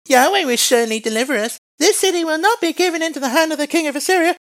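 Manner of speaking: very high put-on voice; high-pitched mock quote; mocking mimicry of Hezekiah. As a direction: parrot the king's promise in a silly high voice. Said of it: "when he says".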